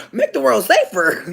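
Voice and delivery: hammed-up mock officer; mock cop voice. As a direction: mimic a cop's slogan mockingly. Mocking police motto, laughing.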